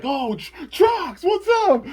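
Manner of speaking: mock-excited squeal, very high and loud; hammed-up, high-pitched thrilled shout; excited greeting. Brawlers greeting Trox as the Bakugan show up, squealing with excitement.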